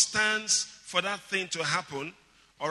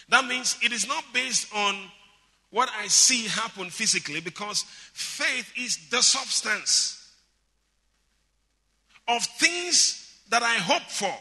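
raised, higher preaching voice; impassioned preaching; 5 semitones higher, 6 dB louder than usual. Real, not acted. Preacher telling believers they already have assurance, impassioned. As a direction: drive each point home to the congregation.

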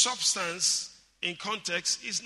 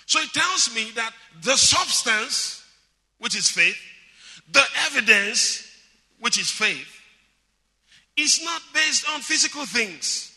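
raised, higher preaching voice; impassioned preaching; 5 semitones higher, 6 dB louder than usual. Real, not acted. Preacher telling believers they already have assurance, impassioned. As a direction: drive each point home to the congregation.

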